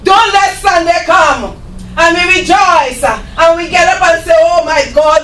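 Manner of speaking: loud, high preaching; revival preacher. Acted. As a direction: preach it out with force. Preaching at full force.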